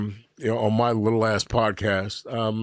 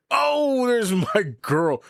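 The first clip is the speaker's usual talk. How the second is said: excited; 12 semitones higher, much slower than usual. Real, not acted. Greeting a favorite viewer.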